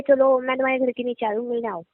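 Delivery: normal talking voice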